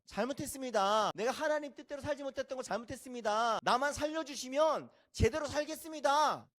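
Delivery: high, loud pleading; repentant prayer. Acted. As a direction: beg God for mercy, crying out. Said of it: "회개하며 기도할 때 뭐라고 했어요". (회개하며 부르짖는 기도)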